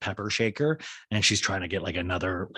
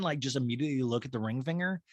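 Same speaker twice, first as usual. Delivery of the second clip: ordinary talk, nothing marked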